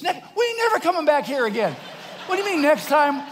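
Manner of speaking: loud, high, incredulous comeback; exasperated retort. Retold or imitated. Husband to wife about eating in Timbuktu, exasperated.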